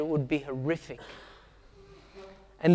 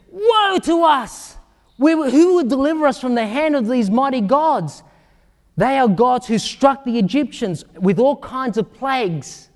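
fearful cry; 6 semitones higher, 4 dB louder than usual. Acted. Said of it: "the Philistines were afraid".

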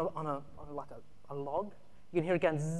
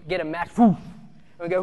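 ordinary delivery

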